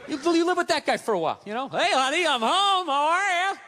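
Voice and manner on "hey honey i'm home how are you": higher, drawn-out mock husband voice; sing-song mockery of Mister Even-keeled coming home. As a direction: imitate an unfailingly agreeable husband. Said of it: "this Mister Even-keeled all the time".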